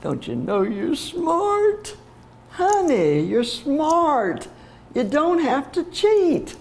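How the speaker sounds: high, slow, choked-up comforting; tearful reassurance. Real, not acted. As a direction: comfort a pupil while crying and half laughing yourself. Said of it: "makes me cry".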